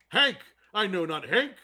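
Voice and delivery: booming, laughing braggart, high and loud; hammy Hercules boast. Retold or imitated. Actor playing Hercules boasting to rider, laughing loud and high. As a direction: roar it like a deranged TV hero laughing at his own crimes. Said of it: "No, he goes".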